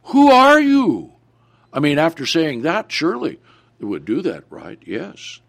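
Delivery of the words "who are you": high, loud, drawn-out challenge; mock-incredulous high "Who are you?"; questioning crowd. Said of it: "they said to him".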